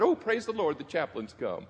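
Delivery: bright, high, gushing praise. Retold or imitated. Patient gushes at the chaplain's arrival, mock cheery and high.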